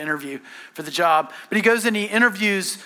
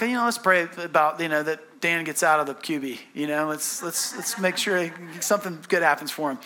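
ordinary talk

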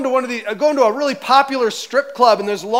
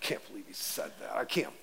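hushed, much softer griping; mock muttering in the car after church; whispered complaining; 11 semitones lower, 14 dB quieter than usual. Churchgoer griping after the sermon, a whispered mock mutter. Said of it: "Kinda like some of you do when you get in your car".

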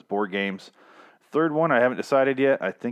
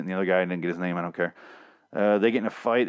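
ordinary talk

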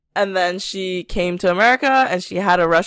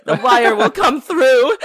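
higher, louder mock Russian-German-American accent, stretched out with laughter; scammer's muddled accent; 6 semitones higher, 5 dB louder than usual. Scammer insisting to deli staff, drawn-out mock muddled accent. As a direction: laugh through an imitation of the mixed accent. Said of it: "with their sunglasses are like".

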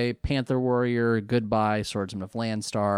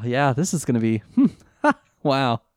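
ordinary talk, nothing marked

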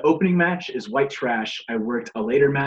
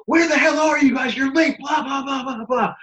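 imitating a frantic, high-pitched yell; freaking out; 12 semitones higher, 4 dB louder than usual. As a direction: act out a man losing it, voice shooting way up. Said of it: "Freaking the fuck out".

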